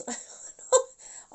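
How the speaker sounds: high breathy laugh; real laugh escaping mid-reading; burst of laughter. Real, not acted. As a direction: break into a sudden laugh.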